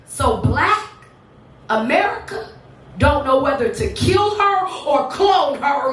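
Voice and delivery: punchy performance poetry with rising set-ups and long pauses; spoken-word poem. Acted. Poet boasting to an audience about mama, proud spoken-word delivery. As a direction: proclaim each line, then land the refrain.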